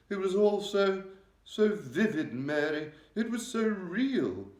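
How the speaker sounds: high, loud, regal voice, shaken; a distressed queen confiding in her maid. A narrator's reading. The Queen telling Mary of her nightmare, regal and upset.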